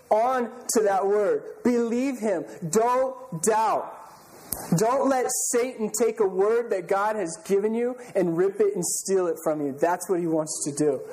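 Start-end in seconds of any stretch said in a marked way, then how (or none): none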